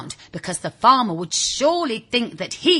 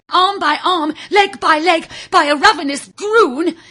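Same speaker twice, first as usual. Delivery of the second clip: higher and louder, building with each phrase; rising dramatic emphasis; 8 semitones higher, 7 dB louder than usual. A narrator's reading. Storyteller spooking the listening children, rising louder and higher. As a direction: pile on the horror, arm by arm, leg by leg. Narrator dramatises being eaten alive.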